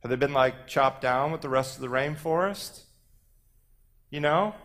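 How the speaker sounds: tentative rising endings with a tacked-on query; questioning uptalk. Acted. Poet mocks speech that lost conviction, questioning uptalk. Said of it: "hip interrogative tone".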